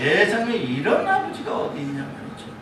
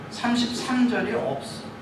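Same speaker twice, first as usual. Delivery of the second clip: ordinary talk, nothing marked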